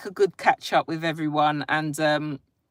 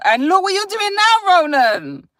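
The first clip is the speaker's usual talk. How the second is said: much higher and louder teasing; teasing gotcha; 14 semitones higher, 8 dB louder than usual. Real, not acted. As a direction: catch him out, playfully. Teasing viewer who denied creating.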